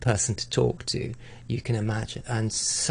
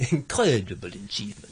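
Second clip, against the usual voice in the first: lightly imitated urbane French accent; a touch of his French accent as you finish; an urbane French accent, lightly done. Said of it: "his incredibly urbane French accent".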